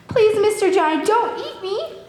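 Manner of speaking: scared pleading. Acted. So I was scared to meet you.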